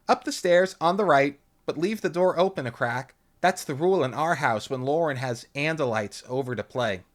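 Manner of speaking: high, loud hostess voice; a too-cheery mom offering snacks; sugary mother voice; Lauren's mother. A narrator's reading. Mother gives directions.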